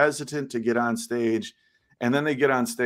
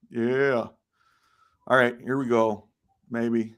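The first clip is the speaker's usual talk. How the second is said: ordinary talk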